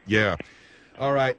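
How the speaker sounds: low, unconvinced sarcastic reply. Real, not acted. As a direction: drop the pitch and agree sarcastically. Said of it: "I detect the sarcasm in your voice".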